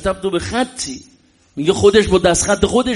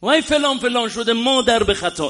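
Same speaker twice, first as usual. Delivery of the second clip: higher, retold angry curse; a retold market curse, raised and sharp; mock yelled insult; 6 semitones higher than usual. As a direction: throw the insult like the angry friend. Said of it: "داد زد".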